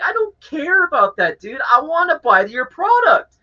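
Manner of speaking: high-pitched exasperated insistence; enraged Karen impression. Customer pleading his case to the manager, exasperated. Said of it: "acting like an enraged Karen".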